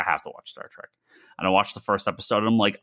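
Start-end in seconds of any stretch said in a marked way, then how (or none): 0.1-0.9 whispered to himself, giving in; a lower, softer, grudging whisper; resigned whisper. Retold or imitated. Recalling his decision to watch again, a resigned whisper.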